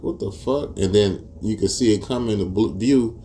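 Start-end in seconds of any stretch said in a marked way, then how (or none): 0.0-0.8 higher, hushed disbelief; stunned. Retold or imitated. Watching the leaked video, a higher, softer stunned what the fuck. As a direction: mutter it in stunned shock. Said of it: "I'm like".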